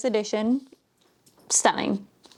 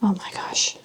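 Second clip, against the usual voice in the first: breathless whisper of delight; awed whisper; 2 semitones lower than usual. Real, not acted. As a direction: whisper it, amazed at the book. Awed at a pretty book.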